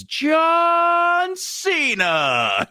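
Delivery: high, slow drawn-out shout; kid shouting name. Retold or imitated. Little kid proudly shouting his superhero name at Spider-Man.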